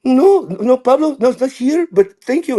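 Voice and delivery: very high put-on mom voice; high mock motherly reply; his mom. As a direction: impersonate your mother answering the teacher. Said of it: "do my mom's voice".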